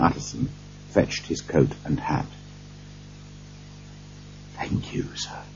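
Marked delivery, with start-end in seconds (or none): 4.6-5.4 very soft, voiceless whisper of thanks; grateful whisper. A narrator's reading. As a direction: whisper your thanks, barely audible. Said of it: "whispered Poole gratefully".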